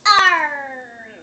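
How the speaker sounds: put-on long loud child's pirate arr; pirate noise. Child showing off their pirate noise, playful pirate roar. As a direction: draw out a falling pirate growl. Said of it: "Show me your pirate noise".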